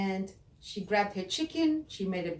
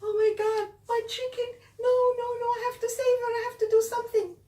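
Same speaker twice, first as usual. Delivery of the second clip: high, loud panicked cries; Sarah panicking over her chicken, high and loud; freaked out; 7 dB louder, much faster than usual. Acted. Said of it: "Sarah freaked out".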